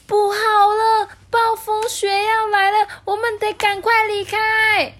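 very high, loud, drawn-out warning; alarmed cry. A narrator's reading. Penguin warns of coming blizzard, alarmed. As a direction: shriek the warning in panic.

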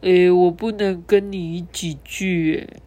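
halting, unsure little voice; hesitant apology. A narrator's reading. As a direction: stammer it out reluctantly. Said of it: "吞吞吐吐地说".

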